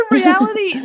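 words spilling through a laugh; laughing as she says it. Real, not acted. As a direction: laugh the word out high. Laughing mid-sentence.

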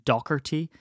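"Doherty" as DOCK-er-tee; hard K in the middle of "Doherty"; common mispronunciation. Shown as a demonstration. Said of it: "You might know him as".

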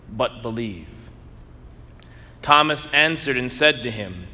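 measured, solemn liturgical reading; gospel reading. Acted. Proclaims the gospel at Mass.

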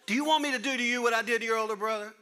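loud fast joking parental threat; mock threat. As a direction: threaten the kid in jest, fast. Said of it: "one day you're gonna do this".

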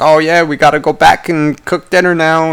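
high put-on voice of a contented beachgoer; relaxed beachgoer. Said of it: "resting, relaxing, being like".

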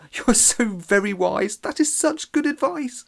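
very high, fluting admiring voice; teacher Terry praising Trevor; gushing teacher. Acted. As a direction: gush over him sweetly.

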